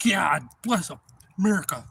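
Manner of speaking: loud, jubilant proclamation; triumphant cheer. Retold or imitated. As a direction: celebrate the catch out loud. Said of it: "I'm like".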